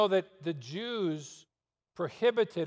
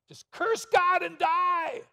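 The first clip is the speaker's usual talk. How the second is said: high, slow mocking shout of Job's wife; Job's wife scolding; 13 semitones higher, 4 dB louder, slower than usual. Preacher mimics Job's wife telling him to curse God, high slow shout. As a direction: shriek it like a bitter wife telling him to give up. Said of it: "Did they take Job's wife's advice?".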